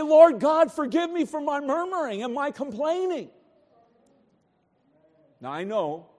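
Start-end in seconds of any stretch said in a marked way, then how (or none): 0.0-3.3 raised pitch, fervent appeal; pleading prayer. Shown as a demonstration. Preacher models a prayer for forgiveness, pleading. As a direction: pray it out emphatically from the pulpit. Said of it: "So we should say".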